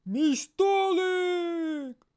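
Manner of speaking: loud, high, very slow wail; merchant's cry. Acted. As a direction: howl over the smashed table. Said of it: "кричав торговець фруктів".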